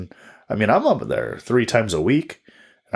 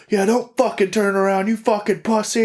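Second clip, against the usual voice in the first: retold scream; 12 semitones higher than usual. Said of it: "he's, like, screaming at me".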